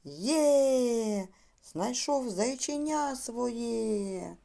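high, long-held joyful exclamation; rejoicing bunny. Acted. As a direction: cheer like a delighted hare, drawing it out. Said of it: "як радів заєць". (радісний високий вигук зайця)

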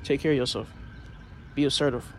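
loud, fired-up motivational speech; loud preaching pep talk. Real, not acted. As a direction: pump them up like a coach.

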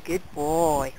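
ordinary talk, nothing marked

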